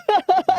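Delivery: very high burst of eager laughter; mock crowd laugh. Seinfeld's crowd laughing before he says anything, very high and eager. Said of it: "you're already like".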